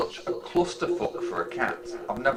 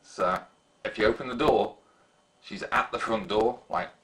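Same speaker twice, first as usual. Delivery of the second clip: ordinary talk, nothing marked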